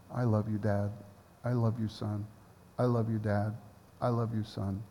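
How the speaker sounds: soft, low, tender repeating. Real, not acted. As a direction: murmur it lovingly. Basking in God's love.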